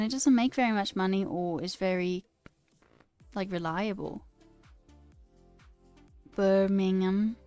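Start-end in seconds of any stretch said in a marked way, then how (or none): 6.3-7.4 "Birmingham" drawn out slowly on a level tone; practising pronunciation. Shown as a demonstration. Streamer rehearsing a British place name, slow careful practice. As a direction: stretch "Birmingham" evenly, syllable by syllable.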